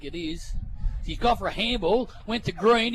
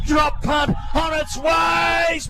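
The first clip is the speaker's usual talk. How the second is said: high, loud, slowing call; excited call; 4 semitones higher, 4 dB louder than usual. Real, not acted. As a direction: hold the breath as the kick flies. Calling the kick for goal.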